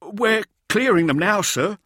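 high, loud, flustered reply; frightened soldier, voice shooting up; stammering. A narrator's reading. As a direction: blurt it to a terrifying superior. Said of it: "stammered one of the Lancers".

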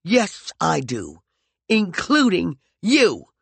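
slow, cold fury on the last words; furious. Acted. Peregrine answers McCoy's insult with slow fury. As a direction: throw it back at him.

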